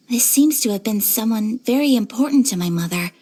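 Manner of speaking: usual speaking voice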